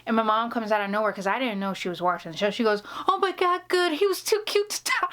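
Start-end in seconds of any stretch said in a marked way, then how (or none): 3.1-5.1 much higher, relieved mom; hammed-up relieved mom; mom gushing. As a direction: sigh over the cute actor like mama. Mom voiced in story.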